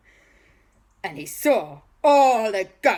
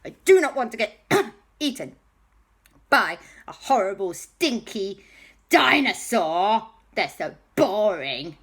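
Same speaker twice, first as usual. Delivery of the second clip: louder, higher petulant villain protest; Balthazar Brat; 4 semitones higher, 5 dB louder than usual. Acted. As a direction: complain with emphatic disgust. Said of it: "Balthazar went".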